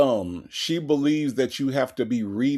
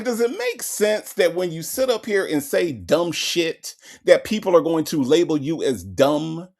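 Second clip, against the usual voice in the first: ordinary talk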